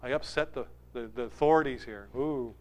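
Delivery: soft, shrinking mock-nervous aside; mock timid wallflower. Preacher mocking a Jesus who would shrink back timidly. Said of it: "Like a wallflower pull back and go".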